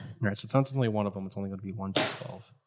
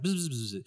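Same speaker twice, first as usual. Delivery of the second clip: soft quick bee buzz; 11 dB quieter than usual. Acted. Game master as bee replying to rider, soft buzzing. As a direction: buzz it under your breath.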